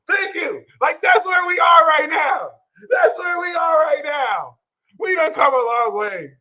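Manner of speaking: high, loud and triumphant; triumphant shout. Real, not acted. To his viewers, a triumphant shout. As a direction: belt it like a victory.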